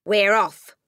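loud, curt announcement; brusque father. A narrator's reading. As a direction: toss it out loudly, too busy to care. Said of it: "Mister Wormwood said, not looking up".

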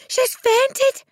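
high, loud shout of alarm; alarmed cry. A narrator's reading. Miss Honey kneeling by the fallen giant, crying out. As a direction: cry it out urgently. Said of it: "she cried".

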